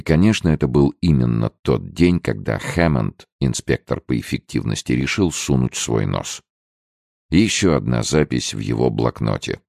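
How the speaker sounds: plain reading voice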